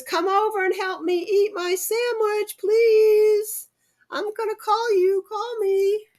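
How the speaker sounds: high-pitched, eager little cat calling friends. Acted. Pete calls his friends to share his sandwich, high eager voice. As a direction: squeak it out like a hopeful kitten.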